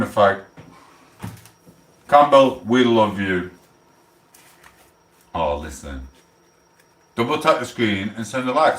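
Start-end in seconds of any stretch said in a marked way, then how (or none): 2.1-3.5 loud call of the name. Real, not acted. Streamer calling out to a viewer, loud hail. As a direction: call out loudly to a friend.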